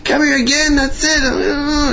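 very high, strained angry squeal; acted-out furious tirade; mock screaming. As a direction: throw him out in a pretend screech. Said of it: "He starts screaming at him".

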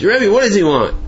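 high-pitched, put-out question; retold indignant questioning; incredulous retort. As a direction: toss it back in disbelief. Retold master questions the messenger.

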